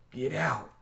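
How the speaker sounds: Johnny ordering his friend out the window; shouted order. A narrator's reading. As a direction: shove the words out urgently. Johnny shoves him to window.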